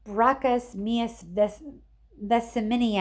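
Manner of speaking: slow, loud shouted curse; boy shouting a spell at the monster; yelled Latin. A narrator's reading. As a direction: hurl the strange Latin, slowly. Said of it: "I yelled".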